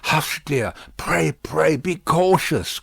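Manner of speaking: anxious cry. A narrator's reading. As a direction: plead with her to be careful, a touch frightened. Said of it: "cried Lucy".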